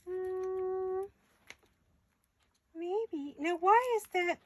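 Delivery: high teasing silly voice; playful mock voice. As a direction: sing it, coy and mocking. Suspicious playful voice.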